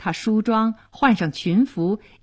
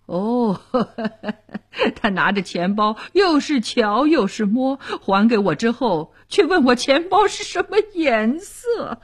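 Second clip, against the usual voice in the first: laughing as he tells a funny story; rindo da piada; 2 semitones higher than usual. Acted. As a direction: chuckle through it, pitch climbing toward the punchline. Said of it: "立刻讲起妻子刚刚闹出的笑话".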